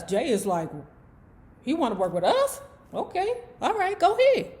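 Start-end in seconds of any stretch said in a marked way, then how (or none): 1.6-4.5 quasi-enthusiasm. Acted. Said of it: "Jay's quasi-enthusiasm".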